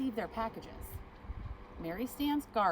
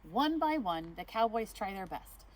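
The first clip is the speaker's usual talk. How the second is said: ordinary delivery, nothing marked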